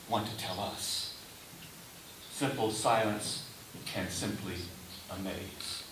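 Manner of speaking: ordinary talk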